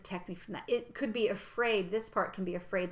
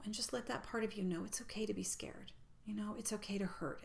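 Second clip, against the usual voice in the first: whispered, soothing; soft whisper; 8 dB quieter than usual. Shown as a demonstration. Coach guides listeners to comfort their fear, whispering. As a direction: whisper it tenderly to the hurting part. Soothing guidance to scared part.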